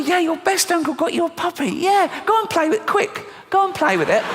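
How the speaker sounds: very high, urgent mock cheer; excited uncle. Uncle sending niece to play quick, high frantic cheer. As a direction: hurry the child along in a high, excited voice.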